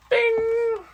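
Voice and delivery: very high playful bing; high bing sound. Real, not acted. Teacher revealing the answer with a high bing. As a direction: chime like a quiz bell.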